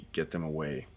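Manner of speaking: normal talking voice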